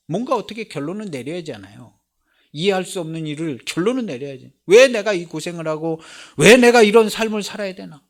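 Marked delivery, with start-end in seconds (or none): 4.7-8.1 loud, high anguished complaint; a sufferer railing at his lot; exasperated lament. Acted. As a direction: cry out why me.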